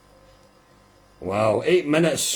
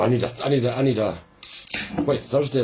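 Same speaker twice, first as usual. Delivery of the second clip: ordinary talk, nothing marked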